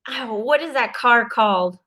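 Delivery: ordinary talk, nothing marked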